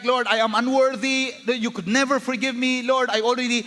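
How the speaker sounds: high, anguished plea to God; condemned pleading. Acted. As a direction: act out a sinner confessing unworthiness. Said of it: "if you're feeling condemned and you feel like".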